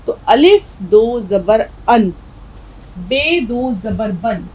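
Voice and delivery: "अन" and "बन" said slowly, each ending in a clear n sound; slow drilled syllables. Shown as a demonstration. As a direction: spell it out slowly like a teacher, landing on "अन" and "बन". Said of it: "ये दो ज़बर जो हैं वो नून की आवाज़ निकालेंगे".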